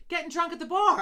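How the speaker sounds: high, loud mimicked outburst; exasperated retort. Quoting the server's answer about the parents, loud and exasperated. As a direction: throw it out loudly, imitating a fed-up server.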